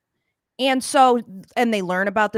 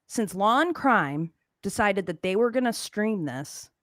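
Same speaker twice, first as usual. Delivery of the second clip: normal talking voice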